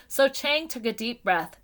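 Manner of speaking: plain reading voice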